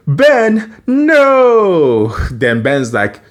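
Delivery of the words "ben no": alarmed cry. Retold or imitated. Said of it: "Gwen's like".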